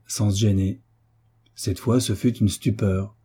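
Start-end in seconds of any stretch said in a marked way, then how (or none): none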